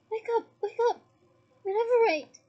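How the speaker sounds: loud, high urgent cries; frantic loud spell shout; crying out. Acted. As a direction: yell the spell in panic. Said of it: "he cried".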